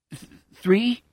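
hesitant, stammered, rising three; unsure question. Acted. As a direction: ask it unsure, stumbling on Th-. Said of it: "with marginal assurance".

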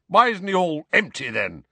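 high, indignant challenge; blustering. A narrator's reading. Uncle doubting nephew about his son, blustering. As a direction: demand it, dropping on the last word. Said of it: "blustered Uncle Vernon".

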